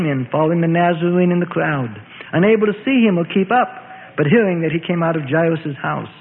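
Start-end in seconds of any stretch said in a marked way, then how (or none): none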